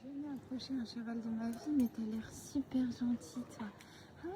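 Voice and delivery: hushed, partly whispered; soft timid coaxing. Real, not acted. To a horse she's never approached, soft and timid. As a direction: speak gently so the horse stays calm.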